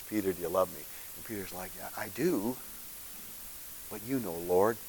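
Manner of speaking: very soft, low, hushed voice; barely above a whisper; hushed exchange. Retold or imitated. Final quiet exchange.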